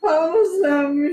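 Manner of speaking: loud tearful answer. Real, not acted. Woman crying loudly to the healer, agreeing. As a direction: cry it out loud.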